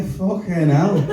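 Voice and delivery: ordinary talk, nothing marked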